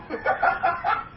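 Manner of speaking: a quick airy laugh at the idea; short amused laugh between points; breathy laugh. Real, not acted. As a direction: laugh it off lightly. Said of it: "I'm laughing".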